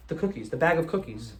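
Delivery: ordinary talk, nothing marked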